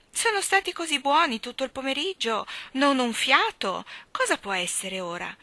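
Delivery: put-on a worried mother cooing at crying children; fussing mother. A narrator's reading. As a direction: coo and fret over the twins. Mother fusses over crying twins.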